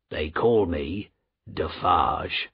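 low, slow character voice; low-voiced, measured reply; guarded commoner. A narrator's reading. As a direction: answer slowly, pitched well down. Commoner answers the Marquis.

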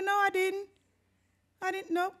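high-pitched, hasty-sounding protest of innocence; innocent denial. Retold or imitated. Storyteller denying smoking to responders, innocent denial.

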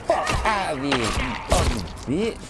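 high furious yell; angry yelling. Real, not acted. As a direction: yell at the attacker. Fighting off attacker.

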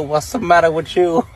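ordinary talk

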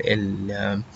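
ordinary talk

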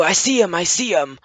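high, loud excited call; excited lookout. Retold or imitated. Players spot hooligans in the distance, excited high call.

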